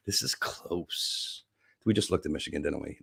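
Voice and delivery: hushed reaction. Real, not acted. Host seeing how close Michigan is, hushed.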